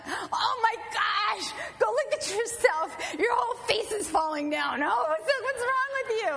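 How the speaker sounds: high, loud panicked shrieking; alarmed friend. Retold or imitated. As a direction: shriek in alarm at her face. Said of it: "I have friends that went on".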